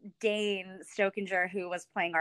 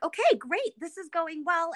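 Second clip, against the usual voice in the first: mock cheerful; 10 semitones higher, 4 dB louder than usual. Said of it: "And I was like".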